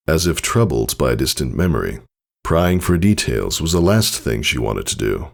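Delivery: ordinary delivery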